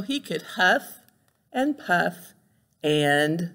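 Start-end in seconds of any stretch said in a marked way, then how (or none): none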